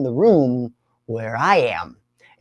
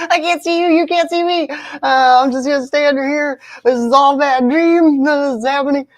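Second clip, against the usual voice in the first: higher, lightly voiced little-kid hiding; childish denial; 12 semitones higher, 4 dB louder than usual. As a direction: pretend nobody can see you. Hiding under blankets, denial.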